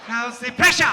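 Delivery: mock German interrogator; hammed-up German accent, building to a shout. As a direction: put on a thick German accent. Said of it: "like they have a German accent".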